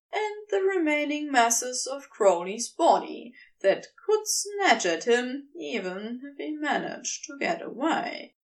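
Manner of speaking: ordinary delivery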